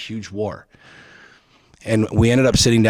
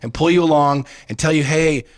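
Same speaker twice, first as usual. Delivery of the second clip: raised, forceful scolding; heated husband scolding wife; heated lecture; 5 semitones higher than usual. Retold or imitated. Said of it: "I'm like".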